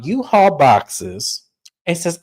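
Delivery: normal talking voice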